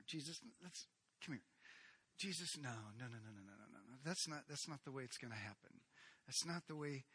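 a confidential word, voice dropped low; hushed aside. Acted. Peter pulls Jesus aside.

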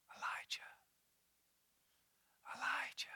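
soft breathy whisper of the name; gentle whisper. Acted. God calling Elijah in the cave, gentle whisper. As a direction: call him in a gentle whisper. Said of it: "there came a gentle whisper".

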